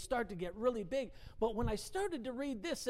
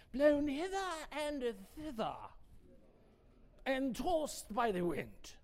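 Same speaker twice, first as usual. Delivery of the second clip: English accent. Shown as a demonstration. Preacher rereading the verse to the congregation in an English accent. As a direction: read it like a stately English recitation. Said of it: "say that with an English accent".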